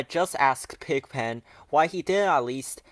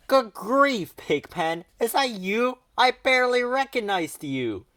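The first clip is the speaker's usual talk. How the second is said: higher and louder in amazement; surprised Charlie Brown; 9 semitones higher, 5 dB louder than usual. A narrator's reading. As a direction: gasp out the surprise. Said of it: "he said".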